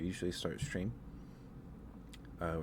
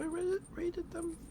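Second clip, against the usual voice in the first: squeaky voice; much slower than usual. Pretend. Squeaky puzzled self-question.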